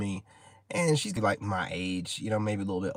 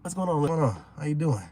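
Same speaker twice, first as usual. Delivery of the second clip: lightly raised eager greeting voice; a hint of an excited woman; 7 semitones higher than usual. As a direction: greet him like an eager fan. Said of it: "she's like".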